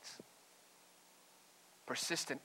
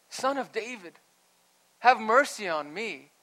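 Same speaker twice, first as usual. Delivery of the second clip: raised, higher plea for mercy; crying out; 7 semitones higher, 8 dB louder than usual. Retold or imitated. Woman cries out to Jesus for mercy, raised pleading voice. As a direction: call out pleadingly, pitched up. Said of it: "cries out".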